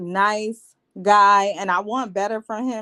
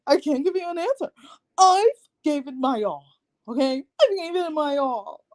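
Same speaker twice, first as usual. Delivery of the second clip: high, sobbing mock cry; fake-sob through the words; 7 semitones higher than usual. Mimicking Alyssa breaking down in tears. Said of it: "she starts crying".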